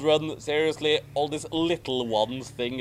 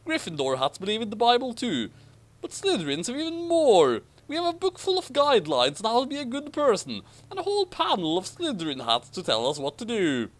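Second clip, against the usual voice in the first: much higher, fast, eager pitch; excited; 7 semitones higher, faster than usual. Acted. Ron boasting about Slytherin rules, excited and fast. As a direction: rattle it off like a proud salesman. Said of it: "Ronald continued excitedly".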